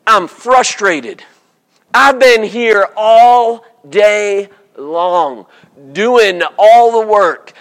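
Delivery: slow, dragged complaint of a worker; frustrated worker. Retold or imitated. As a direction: drag out the grievance slowly. Voicing frustrated worker slowly.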